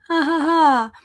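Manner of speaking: awkward high laugh; nervous laugh. Real, not acted. Laughs after confession.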